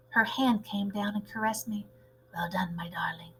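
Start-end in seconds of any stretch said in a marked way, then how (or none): 2.3-3.3 soft, low whispered praise; whispering. Acted. Grandmother secretly praising the mouse, soft whisper.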